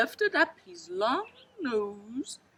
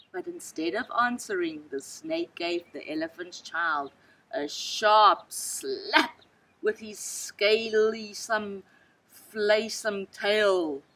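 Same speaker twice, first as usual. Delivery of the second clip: ordinary delivery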